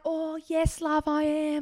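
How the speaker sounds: put-on British accent, soft and lilting; a hammed-up British accent; fake British accent. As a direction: play the Aussie faking a British accent. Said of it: "talking in a British accent".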